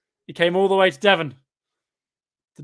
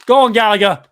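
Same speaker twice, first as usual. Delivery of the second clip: loud, higher cheer for the player; real fan cheering at the screen; excited cheer; 4 semitones higher, 12 dB louder than usual. Real, not acted. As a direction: urge him on at full voice. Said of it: "Why am I getting excited?".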